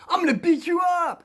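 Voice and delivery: loud, high repeated threat; determined intimidation. Retold or imitated. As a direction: imitate a bully bent on scaring someone. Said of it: "he was very determined to try to get fear".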